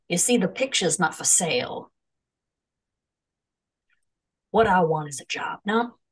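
put-on lightly done mid-Atlantic accent; old movie star mid-Atlantic accent. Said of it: "I'm wondering if I can do a mid-Atlantic accent".

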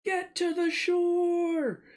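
high, stretched-out shout; drawn-out yell. Acted. Player yells to party in character to get to shore, long high shout. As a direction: yell it long and high, as if calling across a battlefield. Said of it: "I'm just gonna yell a comment".

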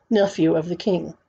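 ordinary delivery

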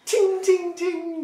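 high, ringing "ting" noises; imitated crystal bouncing. Game master sounding out the dropped crystal, high ringing imitation. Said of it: "The crystal bounces across the floor".

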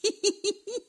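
high playful giggle; cheeky little laugh. A narrator's reading. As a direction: laugh at your own pun. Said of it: "rigola Joligrain".

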